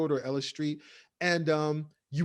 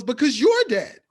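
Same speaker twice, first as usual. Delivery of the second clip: blurted out; 6 semitones higher, 7 dB louder than usual. Retold or imitated. Agnes blurting.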